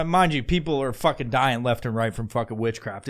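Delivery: ordinary talk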